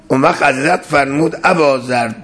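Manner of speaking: normal talking voice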